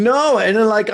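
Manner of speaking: ordinary delivery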